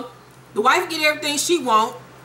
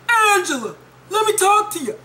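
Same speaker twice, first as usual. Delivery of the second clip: high, whiny calling out; mock a lovesick man begging after her; mock pleading call; 8 semitones higher than usual. Calling across the street, mocked.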